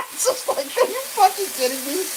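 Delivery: can't believe it, half laughing; high incredulous laugh-talk; laughing disbelief. Real, not acted.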